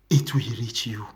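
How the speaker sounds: low, half-whispered warning; grave whispered aside; ominous warning. Real, not acted. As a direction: drop into a breathy, low caution. Warning of coming consequence.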